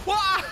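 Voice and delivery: high, loud sudden yelp; high startled cry. Real, not acted. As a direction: yelp in shock. Startled in game.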